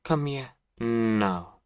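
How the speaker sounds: second warning, firm and loud; stern repeated command. Acted. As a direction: make her obey this time. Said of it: "Don't ask twice".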